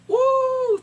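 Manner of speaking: very high, loud drawn-out woo; falling whoop. Retold or imitated. Narrator miming a roof throw, high loud whoop. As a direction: whoop like someone flung through the air.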